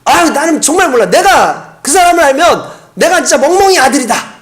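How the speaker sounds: loud, high furious swearing; cursing denial. Retold or imitated. Peter swears angrily at the crowd, loud and high. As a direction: swear it off in panic. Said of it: "아주 심하게 욕을 하는 거죠".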